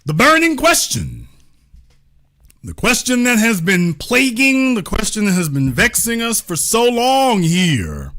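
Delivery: booming, rolling announcer welcome over music; grand show host intro. Acted. Host welcoming brothers and sisters worldwide, grand announcer read. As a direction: sell the show like a proud broadcaster.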